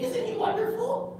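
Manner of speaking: put-on high, breathy kid gushing over his fish; a happy little boy in love with his goldfish; high puppet kid, slowed, gushing; childlike puppet voice. Puppet Gus speaks.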